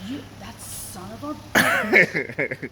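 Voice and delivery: mock-furious, every word stretched; retelling the car fight, cursing him out slowly; slow, loud acted curse. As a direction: drag out a loud slow insult. Retold confrontation, acted quote.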